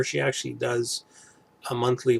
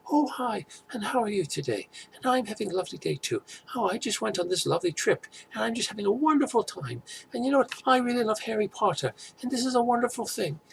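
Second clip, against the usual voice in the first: much higher, sugary-cheerful gushing voice; bubbly YouTuber impression; 11 semitones higher, faster than usual. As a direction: gush like an overjoyed YouTuber greeting her viewers. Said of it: "she actually almost does sound like that".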